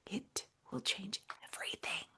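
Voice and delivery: a soft, voiceless, intent whisper. Real, not acted. Host assuring listeners of change, whispered. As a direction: lean in and breathe the promise. Quiet emphatic closing point.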